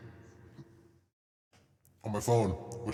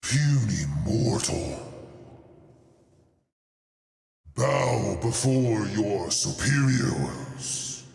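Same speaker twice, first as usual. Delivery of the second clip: booming frost giant; 6 semitones lower than usual. Pretend. Voice changer villain demo.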